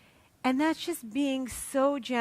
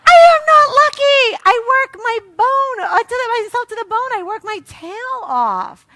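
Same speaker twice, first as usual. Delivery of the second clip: indignant shout; 7 semitones higher, 10 dB louder than usual. Retold or imitated. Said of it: "I'm gonna go".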